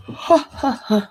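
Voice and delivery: high, breathy chuckle trailing off; short laugh settling down; light laugh. Real, not acted. Chuckles about paper in other room.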